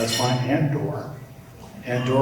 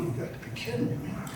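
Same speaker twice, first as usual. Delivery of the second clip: whispered, much softer and higher disbelief; Picasso, exasperated; 11 semitones higher, 11 dB quieter, faster than usual. Acted. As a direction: breathe it out in weary disbelief.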